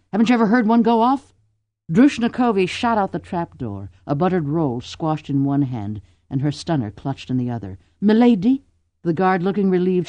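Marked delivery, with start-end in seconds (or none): 0.1-1.3 fast, loud, incredulous jab; exasperated. Acted. Exasperated at ignorance. 8.0-8.6 high, loud call. Acted. Guard bursts out calling.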